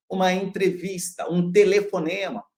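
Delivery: normal talking voice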